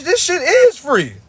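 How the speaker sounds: normal talking voice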